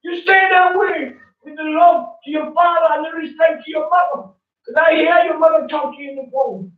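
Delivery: fervent shouting sermon. Real, not acted. Man preaching to a young man about respecting his parents, fervent high shout. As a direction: shout it out like a street preacher, fired up.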